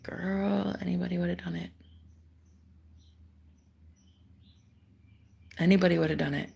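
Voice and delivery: soft whispered comfort; reassuring whisper. Retold or imitated. Roommate comforting her about the knife, whispered. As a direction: whisper it gently to reassure a friend.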